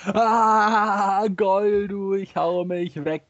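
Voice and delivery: high staged guffaw sliding into words; hammed-up laughing. Marx reacting to Engels' dirty joke, laughing exaggeratedly. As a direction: crack up theatrically, stretching the words.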